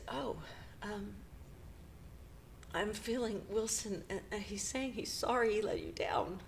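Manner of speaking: a healer passing on a message, whispered; soft whisper. Retold or imitated. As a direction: breathe it like a medium. Healer channeling Wilson.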